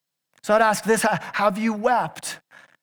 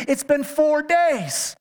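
higher, slow, put-out sister; exasperated Martha; 5 semitones higher, slower than usual. Retold or imitated. Martha telling Jesus the tomb will stink, slow and exasperated. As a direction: drag it out as if it's obvious. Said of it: "She's like".

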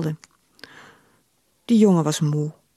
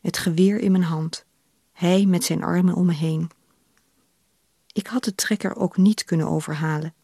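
usual speaking voice

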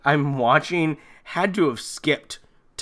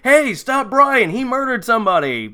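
calling out; 8 semitones higher, 3 dB louder than usual. Pretend. Brad calling to crowded hallways, mock alarm. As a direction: call it down the hall, hammed-up. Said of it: "does he say anything like".

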